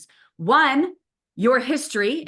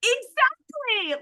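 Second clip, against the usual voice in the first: slow, high, drawn-out told-you-so; a hammed-up knowing remark; knowing exactly; much slower than usual. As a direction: stretch it out knowingly. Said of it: "And I'm like".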